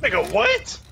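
high loud shocked yelp; loud disbelief. Real, not acted. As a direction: shout it in shock. Louder repeat.

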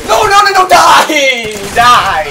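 loud, high shouts easing down; frantic battle yell. Real, not acted. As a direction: yell desperately at an enemy to go down. Fighting an enemy in game.

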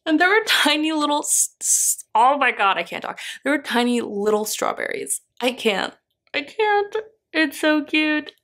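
high, squealing delight; overwhelmed excited squeal; geeking out. Real, not acted. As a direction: gush over tiny strawberries. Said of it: "I'm geeking out".

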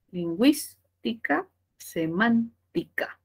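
LINGÜÍSTICA and SEMÁNTICA slowly, split after GÜÍS and MÁN; stressed syllable shown. Shown as a demonstration. Teacher justifying accents to students, lingüística and semántica split at the stressed syllable. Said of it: "justificaciones por esdrújula".

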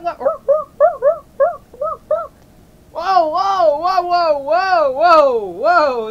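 loud, high, freaked-out cries; alarmed panic. Acted. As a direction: panic loudly at what is happening to you. Panic at finding himself changed.

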